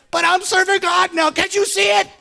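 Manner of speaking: whiny protest; mock self-righteous whine. Mimicking a worried churchgoer, mock pious whine.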